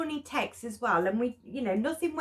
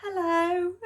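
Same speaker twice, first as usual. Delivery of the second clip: high, timid put-on hello; shy posh hello; 8 semitones higher than usual. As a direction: answer shyly in a posh voice. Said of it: "really shy".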